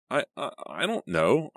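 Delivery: flustered stammer, pitch jumping up; sputtering. A narrator's reading. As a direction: trip over the first words, caught off guard. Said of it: "I sputtered".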